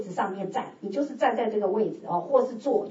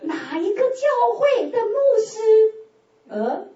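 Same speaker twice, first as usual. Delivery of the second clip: incredulous; 7 semitones higher, 3 dB louder than usual. Retold or imitated. Acting out her disbelieving thoughts.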